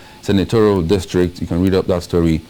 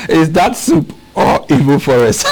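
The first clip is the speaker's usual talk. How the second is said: higher, tickled read-out on the edge of laughter; laughing; 7 semitones higher, 4 dB louder than usual. Real, not acted. Presenter reading a funny reply to co-host, laughing. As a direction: get the punchline out through giggles. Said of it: "I'm laughing because I've seen the reply".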